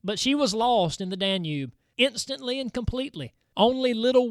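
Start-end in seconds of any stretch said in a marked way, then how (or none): none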